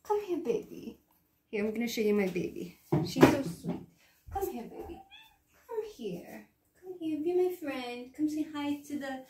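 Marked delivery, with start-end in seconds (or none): 0.0-1.0 higher, softer coaxing coo to the cat; cooing to pet. Real, not acted. 3.1-9.2 cooing to pet. Real, not acted. Cooing to her cat.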